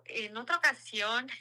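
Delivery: normal talking voice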